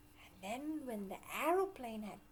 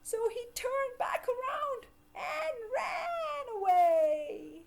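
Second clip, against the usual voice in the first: high, loud frightened wail; scared cry; 12 semitones higher, 10 dB louder than usual. A narrator's reading. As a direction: wail as he runs away. Frightened ant flees.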